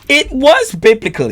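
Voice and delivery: high, loud hype echo; echoing the ad-lib, high and loud; hyped shout. Real, not acted. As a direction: shout it back to the track.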